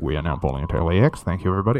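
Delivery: low, quick public-address voice; airport PA announcer. Retold or imitated. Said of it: "The person over the speaker was like".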